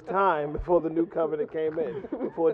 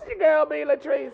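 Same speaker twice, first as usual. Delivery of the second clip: radio host intro; 10 semitones higher than usual. Acted.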